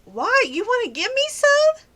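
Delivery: high-pitched, playful question; mock eager teasing. Woman baiting a scammer about money, teasing high voice. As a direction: pitch it way up to needle the caller.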